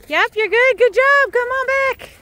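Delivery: very high, bright calling to dogs; excited dog praise. Real, not acted. Walker calls her dogs back, high excited praise. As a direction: cheer the dogs on in a high pet voice.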